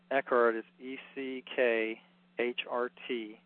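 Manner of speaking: normal talking voice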